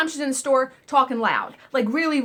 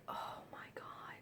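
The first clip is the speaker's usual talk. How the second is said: breathy whispered oh my God under the breath; whispered dismay; 26 dB quieter, slower than usual. Retold or imitated. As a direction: whisper it so the line can't hear. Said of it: "I'm like".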